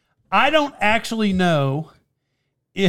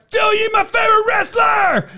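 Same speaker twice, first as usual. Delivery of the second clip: very high, strained fan yell; intense fan; 13 semitones higher than usual. Retold or imitated. Superfan shouting to his favorite wrestler, intense. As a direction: imitate a superfan declaring devotion. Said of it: "he was very intense about it".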